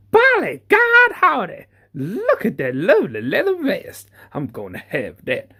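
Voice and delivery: high, hammed-up American cowboy twang; American cowboy accent. Line dancer spotting a leather vest, hammy American cowboy accent. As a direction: play a thrilled Texan line dancer in a hammy American drawl. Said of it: "my accent turned".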